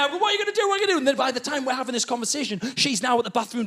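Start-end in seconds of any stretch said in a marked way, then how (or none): 0.2-1.0 high, frantic repeated question, acted; panicking. Husband on toilet questioning wife, panicking. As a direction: press for an answer in high panic. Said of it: "I'm panicking there".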